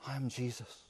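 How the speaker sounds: quiet awed repetition; hushed echo. Acted. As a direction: let the name sink in, barely audible.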